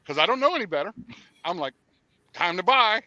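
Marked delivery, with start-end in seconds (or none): 2.3-3.0 eager resolve. Retold or imitated. Retold decision to buy.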